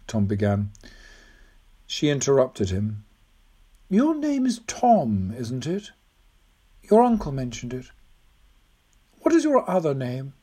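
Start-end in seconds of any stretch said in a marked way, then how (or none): 3.9-10.3 put-on old woman, intent. Old woman questioning the boy at her door, intent and high.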